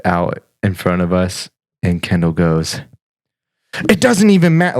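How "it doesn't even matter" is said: put-on high, loud angry kid yelling; angry child. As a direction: play an angry six year old. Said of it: "she gets mad and angry in the back seat".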